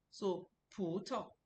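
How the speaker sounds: slow "Pu Tao", long rising "Pu", short toneless "Tao"; Pu Tao with stretched Pu. Shown as a demonstration. Teacher to students, slow model "Pu Tao" with stretched Pu. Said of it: "the way I pronounce the Pu Tao".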